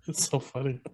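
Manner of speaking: ordinary talk, nothing marked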